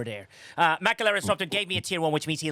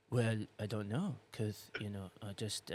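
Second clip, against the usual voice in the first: much softer, low, deflated mumble; flat mumbling imitation; 8 semitones lower, 14 dB quieter than usual. Host mocking Quasel's joke-flattening reply, much softer deflated mumble. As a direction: mimic a mate killing the joke with a limp shrug. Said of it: "Quasel flattens it with".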